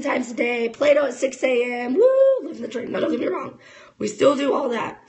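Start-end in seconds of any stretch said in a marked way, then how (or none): 1.9-2.9 high, loud mock whoop; sarcastic cheer. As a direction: cheer it like you don't mean it. Sarcastic about stay-at-home routine.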